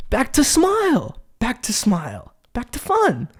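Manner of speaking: playful announcer push; hyped return. Real, not acted. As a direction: bounce back to the topic. Snapping back to topic.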